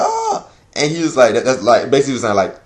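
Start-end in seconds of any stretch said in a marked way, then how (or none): 0.0-0.5 high laughing squeal; mock oh. Fan reacting to the kiss, laughing squeal=83. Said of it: "I'm like".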